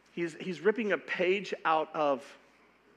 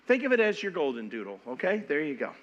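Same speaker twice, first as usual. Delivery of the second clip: normal talking voice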